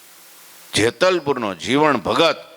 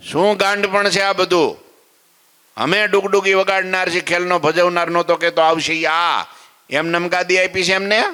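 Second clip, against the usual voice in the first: scolding guru; 7 semitones higher than usual. Acted. Guru scolding Gangaba in story.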